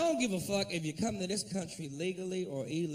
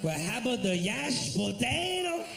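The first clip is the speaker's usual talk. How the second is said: put-on a cashier struggling with the order in a Spanish accent; hammed-up Spanish-accented cashier; mock Spanish accent; slower than usual. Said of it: "It was".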